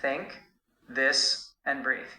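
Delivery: "Think", "this", "breathe" with crisp TH, tongue between teeth; careful teacher demo of TH; clear TH sounds. Shown as a demonstration. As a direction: model each TH word slowly and clearly. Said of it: "repeat after me".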